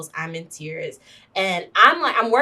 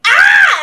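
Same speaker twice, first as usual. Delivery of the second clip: high, loud scream of melodramatic despair; hammed-up shriek of grief; mock anguished scream; 15 dB louder than usual. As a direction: scream as if the news floors you. Acting out collapse at the news.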